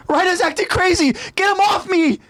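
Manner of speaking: high frantic cry; panicked plea. Acted. Marco begging Annie, high and frantic. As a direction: shout for her to help.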